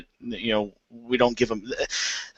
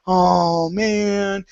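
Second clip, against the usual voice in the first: put-on high, drawn-out childlike letdown; mock disappointment; 9 semitones higher than usual. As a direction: imitate let-down kids with a long, pitched-up groan. Imitates kids let down by store-bought roast.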